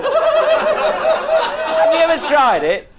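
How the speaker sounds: high, slow words squeezed through laughter; laughing so hard the words go high and slow; laughing question. Real, not acted. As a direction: ask it while laughing hard.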